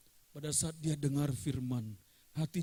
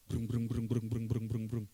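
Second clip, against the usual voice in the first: low mouth engine brung brung; revving motorbike; 4 semitones lower than usual. Acted. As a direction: imitate an idling motor. Motorbike waiting at church.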